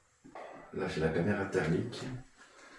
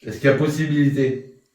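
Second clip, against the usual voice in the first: raised, higher questioning call; calling out to spirits; 8 semitones higher, 8 dB louder than usual. Real, not acted. Ghost hunter addressing an unseen presence, calling out. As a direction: project questions into the empty room.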